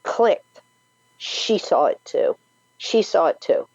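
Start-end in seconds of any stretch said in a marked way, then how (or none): 1.2-2.4 whispered in awe. Real, not acted. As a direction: breathe it out, unvoiced. Stunned realisation, repeated.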